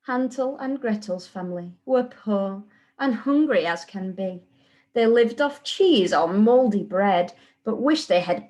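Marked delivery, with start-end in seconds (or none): none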